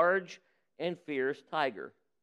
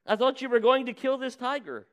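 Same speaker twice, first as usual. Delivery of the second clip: higher pitched alarmed protest; alarmed demand; 8 semitones higher, 3 dB louder than usual. Acted. Holy man protests as the farmer leaves, alarmed and high. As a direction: make him panic, a touch shrill in tone. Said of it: "demanded the suddenly alarmed holy man".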